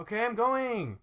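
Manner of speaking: loud, high bratty protest; whining kid. Pretend. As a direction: holler back like a stalling child. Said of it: "like, the kid who's like".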